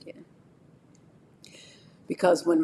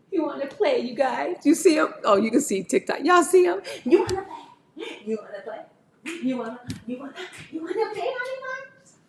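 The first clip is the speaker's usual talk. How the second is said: high, louder playful pet voice; 9 semitones higher, 4 dB louder, faster than usual. Real, not acted. Owner excitedly asking her dog to play, high.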